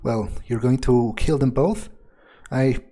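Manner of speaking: ordinary talk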